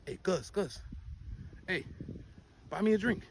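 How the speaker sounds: hushed, sidling plea; imitated begging. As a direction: imitate someone softly hitting up a friend for a drink. Imitates locals begging for drinks.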